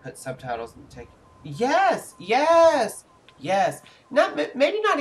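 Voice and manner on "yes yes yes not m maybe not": excited. Real, not acted. Excited reaction while gaming.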